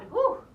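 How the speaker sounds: loud, high breathy whew of relief; relieved whew. Real, not acted. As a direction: blow out a big whew after effort. Breath out after hard exercise.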